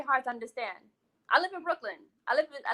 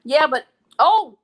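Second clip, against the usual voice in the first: surprised; 12 dB louder, slower than usual. Real, not acted. Sudden reaction while reading comments.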